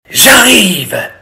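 mildly roared wolf call; roaring wolf. A narrator's reading. Wolf to hiding children, roaring. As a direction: call out with a playful roar. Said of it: "rugit le loup".

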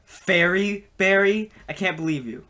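ordinary talk, nothing marked

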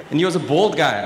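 ordinary talk

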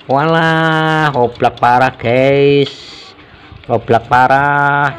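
drawn-out exclamation of dismay; real dismayed discovery. Real, not acted. Mechanic tells viewers the valve is badly loose, drawn-out dismay. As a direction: stretch it out slowly, letting the bad finding land.

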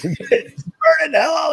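high, drawn-out mock shout; slow, high-pitched pretend yell; mock outburst. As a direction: imitate a ranting performer erupting. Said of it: "as soon as the show starts, he's like".

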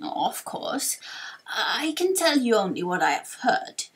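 halting elder with stretched-out words; old storyteller, trailing down at phrase ends; wise old sage, recounting. Acted.

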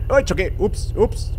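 high-pitched little panic; flustered oops. Real, not acted. Gamer bumping the car while parking, flustered. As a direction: yelp the oops in alarm.

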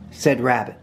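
usual speaking voice

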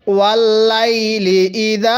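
slow, long vowels on লাইলি ইদা; Quran-reading drawl on ওয়াল লাইলি ইদা; drawn-out recitation. Shown as a demonstration. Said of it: "টান দিব".

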